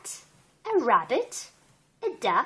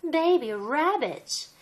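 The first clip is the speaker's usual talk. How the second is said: ordinary delivery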